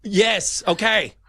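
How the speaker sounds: high, loud busy executive brushing off calls; impatient office boss voice; annoyed boss on the phone. Pretend.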